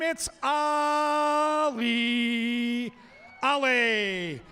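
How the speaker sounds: slow drawn-out name call; announcer hyping the crowd for each corgi; ring announcer intro. Acted. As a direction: stretch the name like a boxing intro.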